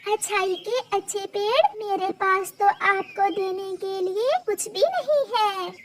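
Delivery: high, soft and quick thanks; grateful little bird. Acted. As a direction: shyly apologise you have nothing to give.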